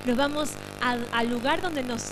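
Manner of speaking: quiet it right down for the end; hushed. Real, not acted. Pastor closes the service gently for the congregation, hushed. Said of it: "Ahora sí, en silencio".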